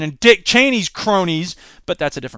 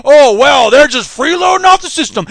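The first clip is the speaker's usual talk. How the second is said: mock smug voter; 4 semitones higher, 7 dB louder than usual. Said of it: "suddenly everybody's like".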